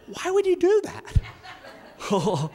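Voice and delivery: drawn-out, high-pitched disbelief; mock-aghast, slow and high; incredulous. Speaker retelling his challenge to the sign holder, incredulous.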